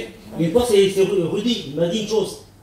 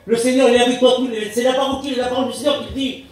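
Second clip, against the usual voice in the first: exhorting preacher; 5 semitones higher than usual. Acted. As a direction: proclaim the promise to the congregation. Preaches God's promise to believers. (prédication exaltée, voix haute)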